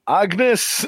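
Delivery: Agnes in a broad Scottish brogue; deep Scottish accent. Shown as a demonstration. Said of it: "I wanna hear it in a very deep Scottish accent".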